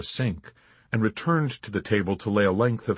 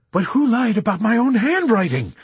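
much higher, louder demand; indignant demand; 13 semitones higher, 7 dB louder than usual. Acted. Chaplain turning on his accusers, indignant demand. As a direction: demand it, anger overtaking fear. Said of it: "demanded the chaplain".

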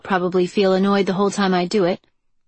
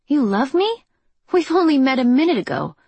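surprised; 6 semitones higher, 3 dB louder than usual. A narrator's reading. Shocked reply to declaration.

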